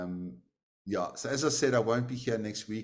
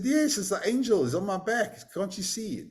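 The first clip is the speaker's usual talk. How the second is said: much higher, earnest insisting; insistent; 10 semitones higher, 3 dB louder than usual. Acted. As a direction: plead that they can see him too. Atisha insists to villagers.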